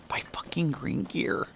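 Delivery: whispered in disbelief; hushed, exasperated whisper; disgusted whisper. Real, not acted. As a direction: drop to a soft, voiceless whisper. Disgusted aside about replaced gear.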